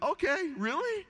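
very high-pitched doubting retort; mock disbelief. Dad calling his boys' bluff, high mock disbelief. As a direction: squeak it out as a teasing question.

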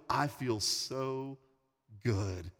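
slow, hushed, dropping low at the end; heartfelt relief. Retold or imitated. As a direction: savor it slowly and softly. Retold heartfelt confession after first giving.

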